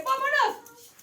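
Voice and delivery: loud, high shout of come on; an eager, rallying call to go. Acted. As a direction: yell it to pull your brother along. Urging brother to come along.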